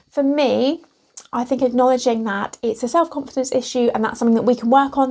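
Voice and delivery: normal talking voice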